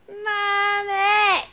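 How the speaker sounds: put-on high, drawn-out child's yell for mom; son yelling. Mother imitates her son at the studio door, sassy high yell. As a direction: imitate a sassy little boy calling through a door. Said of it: "stood by the door and yelled".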